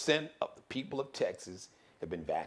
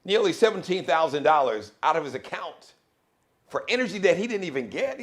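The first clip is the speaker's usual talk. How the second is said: ordinary delivery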